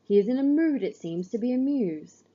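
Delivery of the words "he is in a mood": ordinary delivery, nothing marked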